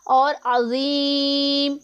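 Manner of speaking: अज़ीम with the long i drawn out very slowly; अज़ीम with a stretched long i; lengthened madd. Shown as a demonstration. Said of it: "इसे हमने कितना लंबा करना है".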